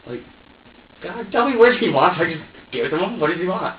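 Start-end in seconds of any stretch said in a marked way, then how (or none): 1.0-3.2 higher and louder, begging God for an answer; exasperated plea. Retold or imitated. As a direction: throw it up to the heavens, fed up.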